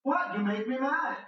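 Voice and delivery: high-pitched angry snapping; heated, exasperated outburst; mad. Acted. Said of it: "I hate it when you get mad".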